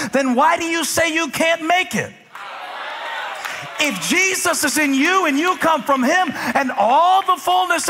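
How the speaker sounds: high, driving preacher's cadence; fired-up preaching. Real, not acted. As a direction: preach scripture with mounting fervor, hammering each phrase. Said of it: "I feel like preaching".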